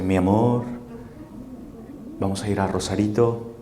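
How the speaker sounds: low, soft seductive murmur; Antonio Banderas. Acted. Antonio Banderas wooing a lady with closed eyes, seductive murmur. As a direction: woo her quietly, drawing close. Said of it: "Antonio Banderas viene a un lado de usted y le dice".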